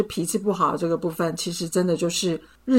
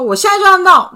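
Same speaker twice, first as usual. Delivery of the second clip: higher, louder defiant demand; reenacting picking a fight, higher and louder; 11 semitones higher, 10 dB louder, faster than usual. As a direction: insist just to pick a fight. Provoking boyfriend in retold fight.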